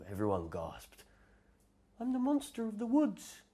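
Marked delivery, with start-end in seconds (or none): 2.0-3.4 very high little creature voice; a small furry monster, meek and squeaky; tiny monster. Acted. The tiny monster introducing itself, very high and meek.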